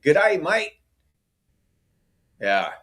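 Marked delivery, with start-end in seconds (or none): 0.0-0.7 mock Australian greeting, pitched much higher; a cheery put-on Australian "Good day, mate"; Australian accent. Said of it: "We gotta practice our Australian accent".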